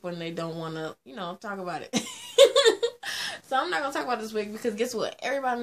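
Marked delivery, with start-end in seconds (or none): 2.0-3.4 high squealing laugh; shrieking laugh. Real, not acted. Vlogger cracking up at her own joke, high shriek laugh.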